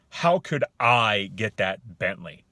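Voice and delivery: loud, slow rhetorical question with falling end; disbelieving tone. Shown as a demonstration. Man answering the offer of a Bentley, disbelieving. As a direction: ask it as if it's impossible. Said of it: "You hear that, that tone?".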